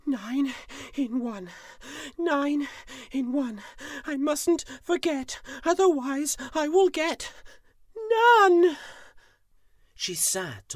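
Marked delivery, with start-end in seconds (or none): 0.0-8.8 high, breathless tiger repeating words; panting. Acted. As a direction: gasp it out, out of breath. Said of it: "She panted".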